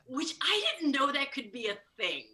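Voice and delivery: ordinary delivery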